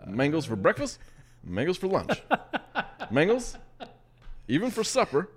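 hammed-up presidential mango pitch; imitating Obama. Host imitates the president for a fruit game, mock impression. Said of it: "I should do, uh, President Obama".

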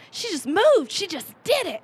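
high, amazed little-sister voice; awed marvelling. Retold or imitated. Younger sister admiring her grown-up sister, awed. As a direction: lift the voice in hammed-up wonder.

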